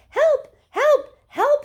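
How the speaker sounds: very high, loud yells; boy yelling for help from the river. Acted. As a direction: yell for help as if drowning. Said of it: "he yelled".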